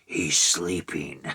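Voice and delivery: slow, low mock superhero voice; Batman. Quoting the parody videos, hammed-up Batman voice. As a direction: draw it out low like a spoof Batman. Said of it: "like Batman from ... the Batman YouTube videos".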